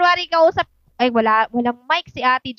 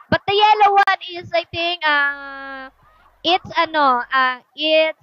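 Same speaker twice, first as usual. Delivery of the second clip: ordinary delivery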